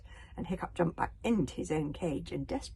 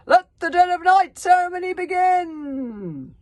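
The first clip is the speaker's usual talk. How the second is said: slow, huge shout, much higher and louder; booming shout; 13 semitones higher, 15 dB louder, slower than usual. Acted. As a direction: bellow it across the clearing. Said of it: "and shouted out".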